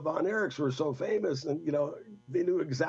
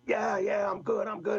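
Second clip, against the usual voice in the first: shouting back from inside the car trunk; shouted reply; 6 semitones higher than usual. Retold or imitated. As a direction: holler back that you're fine.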